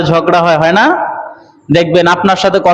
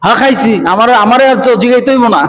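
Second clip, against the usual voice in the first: irritated reply pitched higher; mildly cross retort, voice raised in pitch; annoyed brother; 5 semitones higher than usual. Retold or imitated. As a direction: imitate a huffy brother brushing off questions. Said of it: "চেতা খুব".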